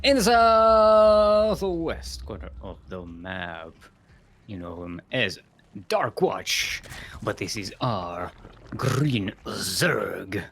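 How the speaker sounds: showy introduction ending on a long-held name; match announcer. Acted. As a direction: open with a huge stretched shout, then present the player.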